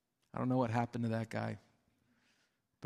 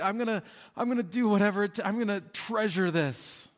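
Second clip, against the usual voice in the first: overjoyed; 8 semitones higher, faster than usual. Acted. Man who found treasure in the field, bursting with joy. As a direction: burst out with delight at the find.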